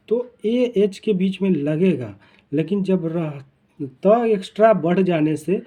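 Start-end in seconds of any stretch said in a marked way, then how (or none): none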